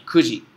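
Kuji said "ku-ji", no glide; model for repetition. Shown as a demonstration. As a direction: model Kuji for the class to repeat. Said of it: "kurikaishi kudasai".

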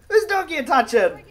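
heated, high-pitched demand; angry challenge. Pretend. Acted threat to the dog thief.

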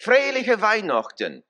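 "Freiliche Weihnachten" in German dialect, high and bright for the congregation to repeat; German dialect, leading. Shown as a demonstration. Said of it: "I'll let you do it in the dialect".

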